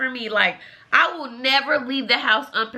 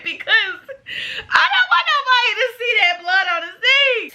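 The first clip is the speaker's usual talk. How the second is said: high-pitched wail through laughter; laughing squeal; 9 semitones higher than usual. Retold or imitated. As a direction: squeal it, half laughing, embarrassed. Laughing, appalled at the stain.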